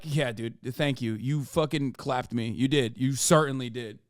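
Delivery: flat sarcastic mock gratitude; deadpan sarcasm. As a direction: drip sarcasm as if conceding defeat. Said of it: "And it's like".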